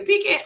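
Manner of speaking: "Pecan" said high, as PEE-can; pronounced PEE-can. Shown as a demonstration. Asking the chat which one y'all say, PEE-can. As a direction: ask "Pecan" rhyming its end with can. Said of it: "Which one y'all say?".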